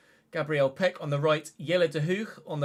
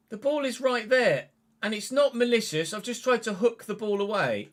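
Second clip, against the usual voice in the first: higher and louder, defending himself; indignant protest; 7 semitones higher, 5 dB louder than usual. Real, not acted. Gamer arguing against the referee's decision, indignant protest. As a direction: argue the call indignantly.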